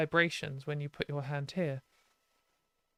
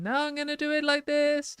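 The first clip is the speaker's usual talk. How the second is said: much higher, near falsetto top; even higher pitch; 11 semitones higher than usual. Shown as a demonstration. Showing viewers the top of the range, very high voice. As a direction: push it far up the range.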